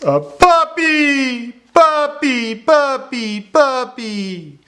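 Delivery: very high, drawn-out squeal of puppy; loud excited exclamation. Shown as a demonstration. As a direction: shout it high with big emotion. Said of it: "you really want to get louder there, show that emotion".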